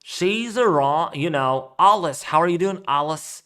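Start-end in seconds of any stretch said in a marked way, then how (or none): 1.8-3.4 Alice said as AH-lis with a German tinge; broad ah vowel. Shown as a demonstration. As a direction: greet Alice with an open ah. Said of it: "I'll say my A's like ah".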